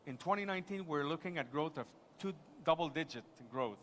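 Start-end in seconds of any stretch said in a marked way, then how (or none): none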